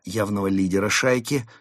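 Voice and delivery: ordinary delivery, nothing marked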